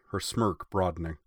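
plain reading voice

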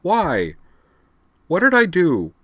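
high, loud plaintive wail; miserable. A narrator's reading. Rena asks why Amanda sent her away, miserably. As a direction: plead wretchedly, pitched high. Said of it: "Rena replied miserably".

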